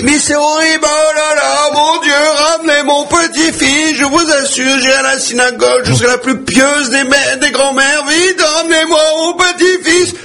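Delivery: very high, drawn-out hammed-up lament; wailing grandmother. Grandmother begs God to bring back her drowned grandson, hammed-up wailing. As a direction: plead to heaven in a long comic high wail. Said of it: "oh, la grand-mère".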